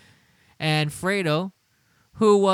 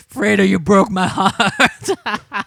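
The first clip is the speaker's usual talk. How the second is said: slow, louder, through laughter; laughing; 4 semitones higher, 7 dB louder, slower than usual. Real, not acted. Streamer reading a joke reply while laughing. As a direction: laugh the words out.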